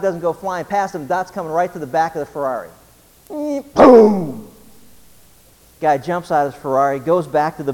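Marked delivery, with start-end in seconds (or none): 3.3-4.5 a loud, high "boom!" like a crash; crash sound effect. Retold or imitated. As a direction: belt out the crash noise. Moped hits the Ferrari.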